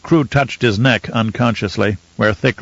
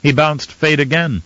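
plain reading voice

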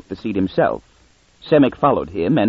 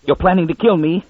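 higher snarling accusation; 7 semitones higher than usual. A narrator's reading. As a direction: snarl it at the others in panic. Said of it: "Munn snarled".

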